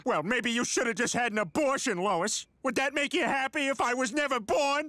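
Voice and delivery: high, petulant outburst; childish tantrum. Acted. Husband argues back at scolding wife, childish tantrum.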